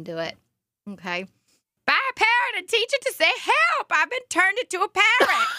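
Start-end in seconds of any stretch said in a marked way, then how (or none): 1.9-5.5 high, bright politician voice reading a resolution; a laughing Kamala Harris read; Kamala Harris impression. Reading a resolution to her friend as Kamala Harris. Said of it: "how she does Kamala Harris".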